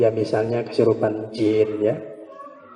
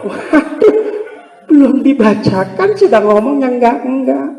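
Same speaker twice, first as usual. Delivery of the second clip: high, loud laughing exclamation; 10 semitones higher, 6 dB louder than usual. Real, not acted. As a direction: laugh it out in disbelief. Laughing at a bad bedside remark.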